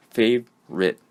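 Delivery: "favorite" in two beats, fav, rit, middle o skipped; syllable by syllable. Shown as a demonstration. Teacher showing the o is skipped, two separate syllables. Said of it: "it is two syllables".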